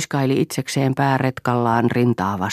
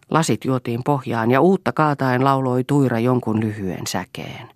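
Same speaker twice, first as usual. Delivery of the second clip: ordinary delivery, nothing marked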